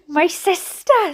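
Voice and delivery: high, drawn-out mild scream; mother's scream. A narrator's reading. Mother bursting into hospital reception, screaming. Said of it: "screamed my mother".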